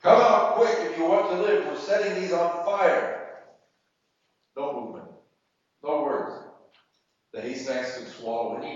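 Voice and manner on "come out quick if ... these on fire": loud, high shouted warning; raised voice, pitched high and forceful; yelling. A narrator's reading. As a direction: yell an urgent threat at people in hiding. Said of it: "The interpreter started yelling".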